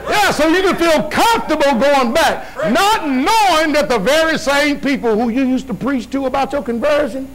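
rising pulpit intensity; preaching. Acted. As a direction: build the sermon up with fire. Preaching at full force.

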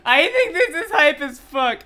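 loud, high and laughing; hyped delight. Real, not acted. Fan defending Steve's reveal, hyped delight. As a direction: declare it loudly through excited laughter.

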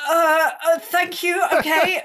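high, loud, halting reply; baffled thanks. Retold or imitated. Musician baffled by a star's compliments, flustered. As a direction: imitate someone flummoxed by praise.

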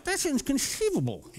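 hammed-up inconceivable; Princess Bride quote. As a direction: fling the line like the film villain. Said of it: "quote Princess Bride".

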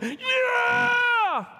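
long, high, loud held cheer; victory shout. Retold or imitated. People shouting in faith before the wall comes down, high loud held cheer. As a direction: cheer like you expect the wall to fall.

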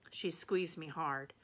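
ordinary delivery, nothing marked

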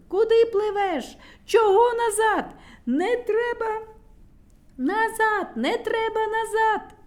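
high, loud yelling across water; yelling crowd. A narrator's reading. The little ones yell at Burchun from the bridge. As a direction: yell at the swimmer to stop. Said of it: "кричали одні".

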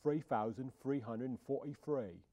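hammed-up Cockney demo; Cockney accent. Shown as a demonstration. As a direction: put on broad Cockney, every th in "three thousand three hundred and forty-three" turned to f. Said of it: "how to speak Cockney".

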